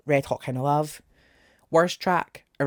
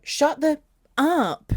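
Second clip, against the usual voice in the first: mocking quote; 8 semitones higher, 4 dB louder, slower than usual. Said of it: "Why are we going".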